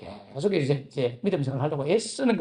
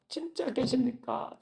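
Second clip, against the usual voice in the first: hushed plea; 5 semitones higher, 9 dB quieter, slower than usual. Retold or imitated. Desperate prayer asking if God exists.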